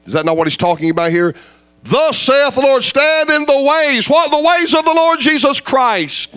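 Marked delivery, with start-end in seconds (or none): 1.8-4.1 preaching scripture. Acted. 4.1-6.4 full-force preaching. Acted. Preacher answers his own question.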